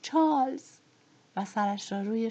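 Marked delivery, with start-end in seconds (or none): 0.0-0.7 a higher, louder sobbing cry of the name; Pitty's tearful cry. A narrator's reading. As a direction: wail the dead husband's name. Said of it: "حق حق کنان".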